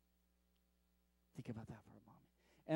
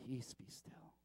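whispered, a breath of calm; 6 semitones lower, 12 dB quieter, slower than usual. Acted. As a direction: whisper it like soothing a child. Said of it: "repeated softer as whisper".

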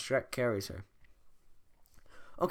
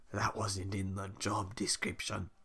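gruff ogre voice; 4 semitones lower, 5 dB quieter, slower than usual. Acted. Voicing Shrek.

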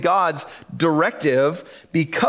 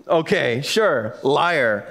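sarcastic disbelief; 5 semitones lower, much slower than usual. Retold or imitated. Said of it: "And we're all like".

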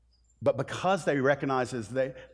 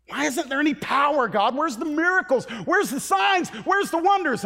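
much higher, louder, building to a shout; crying out to God; 7 dB louder than usual. Acted. Church prays demanding signs and wonders, building to a shout. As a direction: plead with God, rising to a cry.

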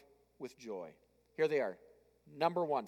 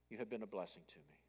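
softer repeat; 10 semitones lower, 15 dB quieter, faster than usual. Retold or imitated. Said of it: "what Paul was basically saying".

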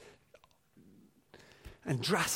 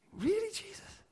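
incredulous; 6 dB quieter than usual. Real, not acted. Incredulous aside.

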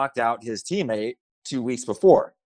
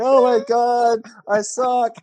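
higher, mock-despairing wail; despairing self-pity; 9 semitones higher, 4 dB louder, slower than usual. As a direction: groan it like a crushed fighter. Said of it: "I'm like".

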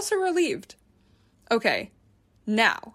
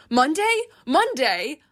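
rising incredulous repeat of Monday; stunned, asking Monday? Monday? with a rising tune; disbelief; 6 semitones higher, 3 dB louder, slower than usual. A narrator's reading. Disbelief at weekend stay.

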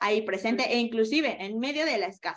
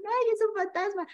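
higher, startled mock shriek of fear; mock scared cry; 11 semitones higher than usual. Teacher acting disciples crying out in fear, mock fright. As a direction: cry out like you've seen a ghost. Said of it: "se pusieron a gritar y dijeron".

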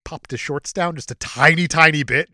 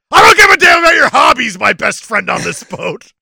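mock shout; 7 semitones higher, 13 dB louder than usual. Jack snaps at his friend.

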